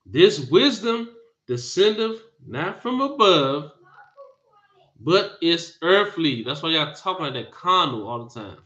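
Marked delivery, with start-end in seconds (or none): 0.1-6.4 slow it down and lean on the scripture; slow, deliberate reread. Shown as a demonstration. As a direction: read it again slowly, weighing each word. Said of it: "Read again".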